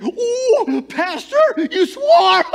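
very high, loud mocking squeal; hammed-up scandalized shriek, stretched out; gleeful gotcha. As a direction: crow it like catching someone red-handed. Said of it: "Like he caught me or something".

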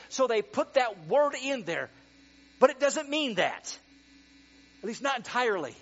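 building from firm to loud and high; preaching at full force. Acted. Pastor rousing the congregation, loud preaching.

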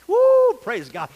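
loud, drawn-out praise; joyful woo praise God; slow loud woo. Real, not acted. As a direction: rejoice as you catch it. Ball game demo with Matt.

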